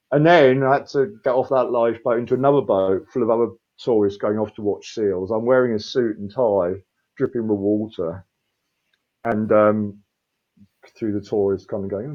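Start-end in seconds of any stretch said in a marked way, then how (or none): none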